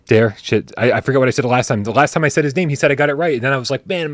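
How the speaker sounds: normal talking voice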